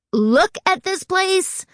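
high, drawn-out exclamation of delight; wowed, high and stretched out; excited exclamation. A narrator's reading. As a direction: exclaim it slowly, pitched right up. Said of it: "George exclaimed from the back seat".

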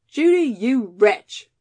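slow, loud mock reproach; playful scolding. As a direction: scold her fondly.

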